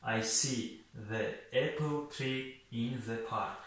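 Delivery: very slow, each word spaced out for learners; a teacher's careful slowed-down model sentence; slow and simple. Shown as a demonstration. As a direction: spell it out slowly so students can follow. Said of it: "осонтар карда талаффуз кунем".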